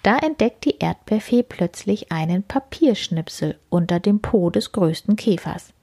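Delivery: ordinary delivery, nothing marked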